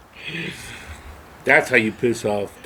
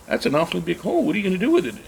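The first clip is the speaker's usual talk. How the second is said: high-pitched indulgent neighbor voice; neighbor's question; 8 semitones higher, much faster than usual. Retold or imitated. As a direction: ask like an adult humoring a child. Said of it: "And she goes".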